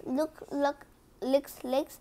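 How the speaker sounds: ordinary delivery, nothing marked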